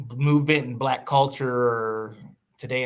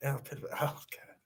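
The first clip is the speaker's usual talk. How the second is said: unvoiced whisper, much softer; real whisper; 14 dB quieter than usual. Real, not acted. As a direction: breathe it out under your breath. Muttered aside while reacting.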